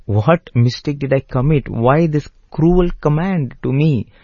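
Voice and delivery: a touch of crying in a wounded brother's question; tearful plea. Acted. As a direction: plead as if hurt by a cruel order. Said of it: "Lakshmana starts crying".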